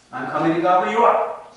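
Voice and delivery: loud, booming troll threat. Acted. Troll threatening to eat the goat, loud booming roar.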